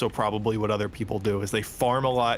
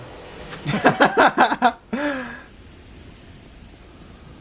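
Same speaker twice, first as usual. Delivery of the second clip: high laugh; 13 semitones higher, 6 dB louder than usual. Real, not acted. Long laugh.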